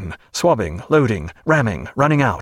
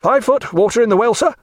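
officer reporting; 10 semitones higher than usual. A narrator's reading. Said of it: "said Stoughton".

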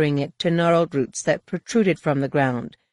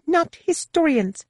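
high and slow, curtly dismissive; sour; 12 semitones higher, slower than usual. A narrator's reading. Guide refuses to play historian, sourly. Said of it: "Daric said sourly".

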